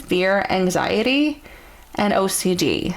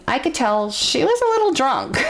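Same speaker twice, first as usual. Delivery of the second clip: words broken by laughter, pitched higher; laughing; 8 semitones higher than usual. Real, not acted. Telling viewers the coworker was drunk, laughing. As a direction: giggle through it as you tell it.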